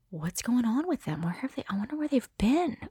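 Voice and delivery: hushed wondering whisper. Pretend. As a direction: whisper it like a private thought. Imagined inner wondering.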